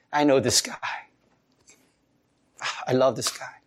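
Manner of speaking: soft, low and tender; hushed and loving, like God claiming a friend. Retold or imitated. Preacher voicing God fondly claiming a believer, hushed and tender. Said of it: "he's saying".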